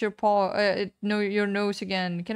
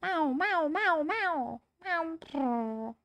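high cute meow; cat meow; 6 semitones higher, 3 dB quieter, much slower than usual. Pretend. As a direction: meow like a kitten. Meowing at cat in game.

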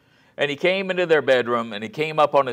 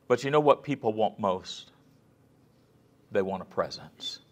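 ordinary talk

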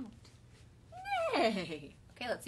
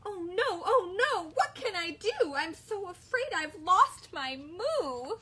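worried cow; 8 semitones higher, 4 dB louder, slower than usual. Acted. Bella's lament in story.

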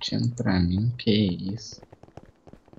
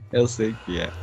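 usual speaking voice

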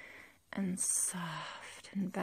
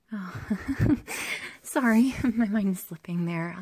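giggling apology; 10 dB louder than usual. Acted. Laughs at own dark thought.

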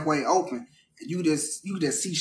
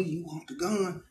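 much softer, slow murmur; whispering mom; 8 dB quieter, slower than usual. Retold or imitated. Mom murmuring to her dazed son, slow and soft. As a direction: lean in and murmur the offer quietly. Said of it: "She whispering to me".

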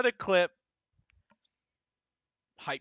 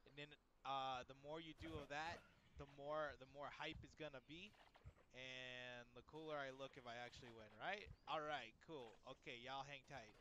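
much softer, coaxing little pep talk; hushed talk to buddies; 2 semitones lower, 21 dB quieter than usual. Real, not acted. Streamer hushedly pep-talks tiny game buddies, much softer.

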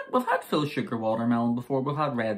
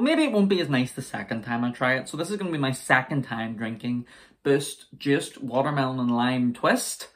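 ordinary talk

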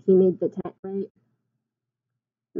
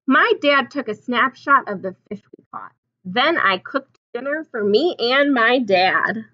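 storybook reading; 3 semitones higher, 6 dB louder than usual. A narrator's reading. Reads picture book aloud.

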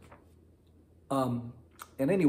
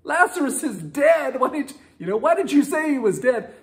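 high, louder baffled questions; confused disciples; 12 semitones higher, 6 dB louder than usual. Retold or imitated. Disciples asking Jesus why he said Lazarus was dead, high baffled. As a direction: ask in disbelief why he said dead. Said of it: "And they're like".

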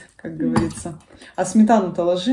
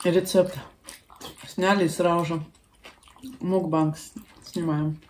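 speaking between bites; chewing while talking; 5 dB quieter than usual. Real, not acted. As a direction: mouth full of food. Seen 0: Left woman talks to camera right after a spoonful, visibly chewing.

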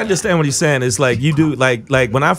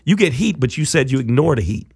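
normal talking voice

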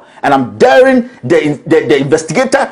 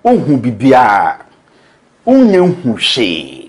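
lower, slow mock voice of a politician; mimicking Kennedy Japan; 6 semitones lower, slower than usual. Host quoting Kennedy Japan, low slow mockery. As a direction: drag it out lower, mocking him. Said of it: "Kennedy Japan say".

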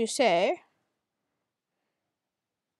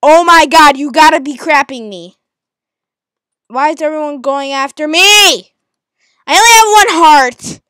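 loud, high outrage; yelled complaint, much louder; exasperated yelling; 5 semitones higher, 14 dB louder than usual. Real, not acted. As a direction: shout at the unfairness. Everyone attacking him.

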